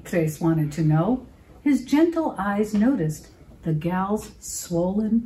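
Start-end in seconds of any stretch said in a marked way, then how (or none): none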